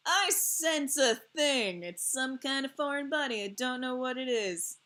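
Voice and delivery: lightly voiced body part, dramatic; voicing her tonsils. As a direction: play the tonsils as a paranoid sentry. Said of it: "They're like".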